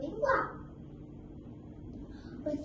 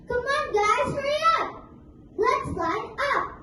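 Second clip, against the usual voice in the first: loud, higher rallying call; 6 semitones higher, 5 dB louder than usual. Acted. Crocodile calling his family to line up, loud. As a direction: hurry the others along.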